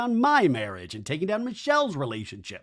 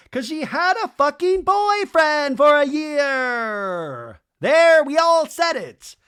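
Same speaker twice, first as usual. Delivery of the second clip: loud, high drawn-out mock holler; sing-songy taunt; 11 semitones higher, 7 dB louder, much slower than usual. Recapper imitating Doty's outburst last season, sing-songy taunt. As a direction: belt it out in a slow, taunting singsong. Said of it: "does a sing-songy thing like we just had that clip".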